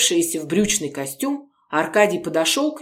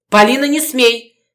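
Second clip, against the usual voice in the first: loud, higher yell; shouting; 6 semitones higher, 7 dB louder than usual. Acted. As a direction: shout to forbid her. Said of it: "снова закричал Городецкий".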